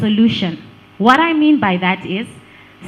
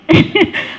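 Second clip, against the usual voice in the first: loud high laugh; real laugh at her own joke; burst of laughter; 7 semitones higher, 5 dB louder than usual. Real, not acted.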